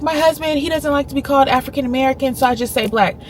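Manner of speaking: high mock apologetic voice; imitating the lady. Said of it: "she's like".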